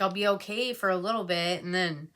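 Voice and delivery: ordinary delivery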